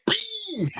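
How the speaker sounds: high imitated "Bing!" of a punch landing; mock punch noise. Retelling a fight, he imitates the punch with a high mock sound. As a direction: make the sound of a punch hitting you.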